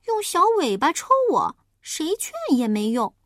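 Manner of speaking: high, loud, lively little pig; a piglet telling about his mother; chatty piglet. Acted. Piglet chatters to the wolf about his mother, high.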